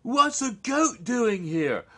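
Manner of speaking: hammed-up shocked squeal; surprised character. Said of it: "character reacts to goat".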